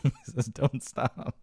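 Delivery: high, laughter-broken retelling; laughing. Real, not acted. Comedian retelling his own punchline, helplessly laughing. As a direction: squeeze the punchline out through laughter.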